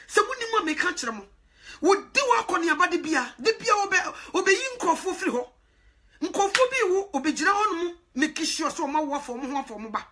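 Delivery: normal talking voice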